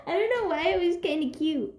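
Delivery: normal talking voice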